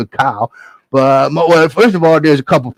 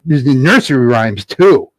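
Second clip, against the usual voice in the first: ordinary delivery, nothing marked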